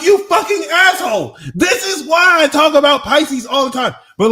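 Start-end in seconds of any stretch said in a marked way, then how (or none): 0.0-1.4 high, loud mock outburst; retold playful yell. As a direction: yell it at a friend in jest, much higher and louder. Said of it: "I'm like".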